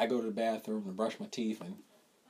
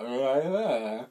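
put-on drunken slur; 4 dB louder, much slower than usual. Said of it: "slurred voice".

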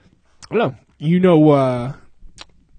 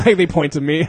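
ordinary talk

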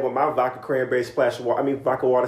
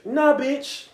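defiant; 10 semitones higher, much slower than usual. Real, not acted. Defiant retort.

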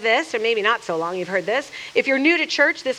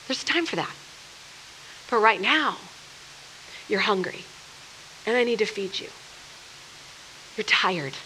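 tender Jesus; 4 semitones lower, 9 dB quieter than usual. Retold or imitated. Jesus to his tired disciples, soft and caring. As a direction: hush down and comfort them.

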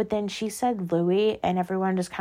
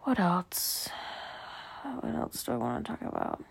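unvoiced whisper to herself; soft whispered aside; 10 dB quieter than usual. Real, not acted. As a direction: breathe it out, thinking aloud. Musing to herself, switching topics.

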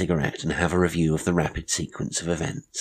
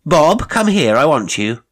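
high, loud summons; compelling ringing call; 10 semitones higher, 10 dB louder than usual. A narrator's reading. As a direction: ring it out as a command. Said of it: "rang out Maud's compelling voice".

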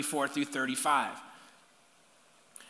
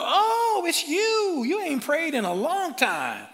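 high, loud sarcastic surprise; high-pitched mock snub; snubbing God; 9 semitones higher, 3 dB louder than usual. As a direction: greet them as a snooty stranger. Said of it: "He doesn't look at you and go".

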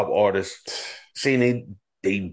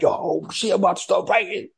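fired up; 9 semitones higher than usual. Retold or imitated. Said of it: "that shit had me like".

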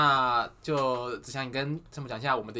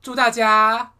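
higher, louder festive blessing; cheerful new year wishes; 6 semitones higher, 9 dB louder, slower than usual. Acted. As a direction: ham up the new year wish.

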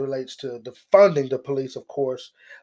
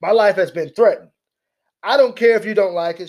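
loud, higher mock of her defiance; mocking defiant yell; 6 semitones higher, 10 dB louder than usual. Commentator mimics the congresswoman defiantly, loud and high. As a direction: throw her words back, loud and defiant. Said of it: "That's how she said it".

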